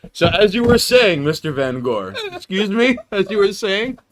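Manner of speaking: loud mock-polite host; hammily steer back to the guest; mock formal.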